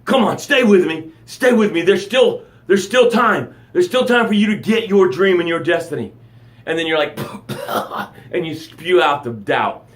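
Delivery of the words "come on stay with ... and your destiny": loud, high urgent plea to stay alive; urgent coaxing. Pretend. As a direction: call them back like reviving a drowning man. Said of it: "And I'm like".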